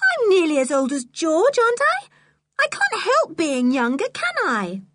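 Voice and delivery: put-on high-pitched indignant little girl; Anne, indignant. A narrator's reading. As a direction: protest in a high child's voice, stung at being called too young. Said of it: "began Anne indignantly".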